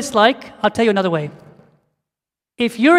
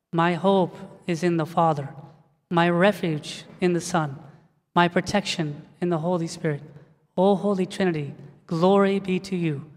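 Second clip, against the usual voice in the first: reverent prayer recitation; 4 semitones lower, 7 dB quieter than usual. Acted. Preacher leading church in a short prayer, soft reverent recitation. Congregation prays together.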